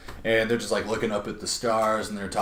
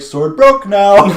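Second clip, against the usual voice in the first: loud, high, slow dumb voice; dopey brute; 9 semitones higher, 15 dB louder, much slower than usual. Retold or imitated. Clark telling Deathstroke the sword is broken, loud, slow and dim. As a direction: say it like a proud simpleton.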